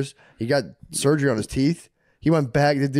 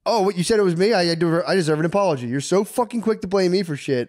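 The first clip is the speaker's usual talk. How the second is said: indignant rant; 6 semitones higher than usual. Retold or imitated. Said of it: "I go".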